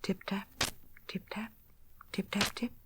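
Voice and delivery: hushed, tiny tapping sounds; soft little tapping. A narrator's reading. Tiny noises under the teacup, hushed tapping. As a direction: tap the words out softly like mice. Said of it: "came those funny little noises".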